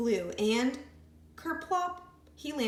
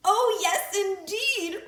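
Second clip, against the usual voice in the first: high, loud, teasing voice; mock his cries; high creature voice; 11 semitones higher, 8 dB louder than usual. A narrator's reading. Creature teasing the piglet about his shouting.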